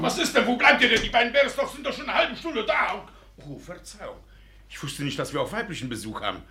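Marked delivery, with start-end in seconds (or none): 0.0-3.1 impatient father calling out. Acted. Father summoning the girls, loud call. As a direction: call loudly into the house.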